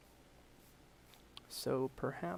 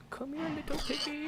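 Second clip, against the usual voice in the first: high, sing-song call to a piglet; cooing animal call; 6 dB louder than usual. Real, not acted. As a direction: coax the piggy over sweetly. Calling a piglet over.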